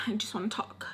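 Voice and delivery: breathless, voiceless whisper; whispered plea. Retold or imitated. Roommate trying to talk calmly to her, whispering. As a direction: imitate a fragile, hushed plea.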